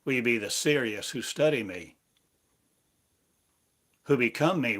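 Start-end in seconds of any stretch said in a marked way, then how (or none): none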